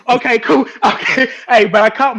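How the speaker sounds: loud, laughing embarrassment; laughing loudly. Real, not acted. Laughing at own slip.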